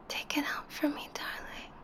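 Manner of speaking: quick hushed whisper close to the ear; right in your ear. Acted. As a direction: breathe it quickly into his ear.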